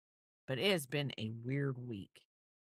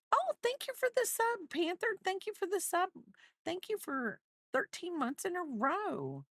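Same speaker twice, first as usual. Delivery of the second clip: excited thanks. Real, not acted. Greeting chat subscribers on stream.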